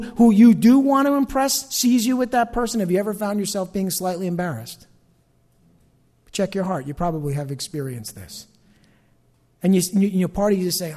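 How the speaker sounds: ordinary delivery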